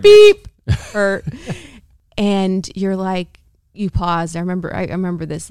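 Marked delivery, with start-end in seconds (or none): 0.0-0.5 very loud, high beep; mock censor bleep. Wife playfully censoring a word on podcast, mock bleep. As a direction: blurt a high bleep over the rude word.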